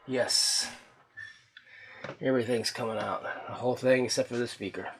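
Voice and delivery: ordinary delivery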